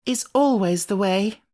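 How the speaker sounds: put-on a child's knowing remark, light; Jane remarking. A narrator's reading. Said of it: "as Jane remarked afterwards".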